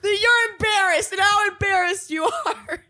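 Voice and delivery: high, loud yell breaking into laughter; laughing mock yell. Joining the retold scene, laughing high mock shout. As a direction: shout it while cracking up. Friend joins mocking the shout.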